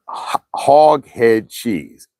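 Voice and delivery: slow, stretched-out hog head cheese; drawn out. Shown as a demonstration. Savouring the dish's name.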